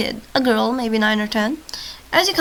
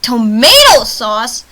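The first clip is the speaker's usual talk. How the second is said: loud, drawn-out questioning disbelief; baffled outburst with a pitch jump; incredulous; 10 dB louder, much slower than usual. Real, not acted. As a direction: stretch it out and query it loudly. Disbelief at a word just read.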